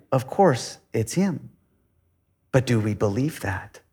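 usual speaking voice

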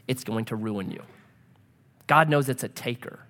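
ordinary talk

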